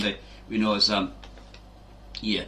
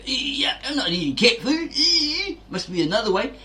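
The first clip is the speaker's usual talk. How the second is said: high, squeaky squeamish voice; mock-prissy high-pitched refusal; squeamish whiner; 12 semitones higher than usual. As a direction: pipe up like a fussy eater refusing. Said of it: "A lot of people out there will go".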